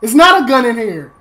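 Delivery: loud frustrated shout; frustrated yell. Real, not acted. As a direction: shout it in desperation. No gun while hiding.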